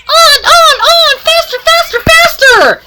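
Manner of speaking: very high, gleeful chant; mischievous high-pitched urging; gleeful egging on. Acted. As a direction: egg them on in a high, giddy squeal. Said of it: "laughed the trucks".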